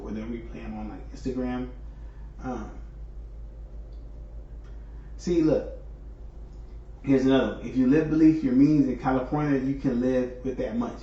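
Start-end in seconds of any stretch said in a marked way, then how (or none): none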